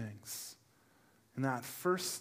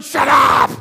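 loud, high yell; husband yelling at his wife in pain; screaming; 10 semitones higher, 20 dB louder than usual. Retold or imitated. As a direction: snap a furious shout back at her. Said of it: "I'm screaming at her".

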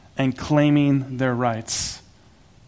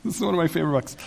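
quieter, self-mocking repeat of This is one of my favorite books; mock self-parody; 7 dB quieter, much faster than usual. Shown as a demonstration. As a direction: softly poke fun at saying "favorite books" yet again. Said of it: "I say that all the time".

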